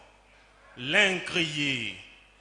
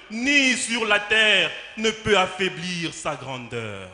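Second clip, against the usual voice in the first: normal talking voice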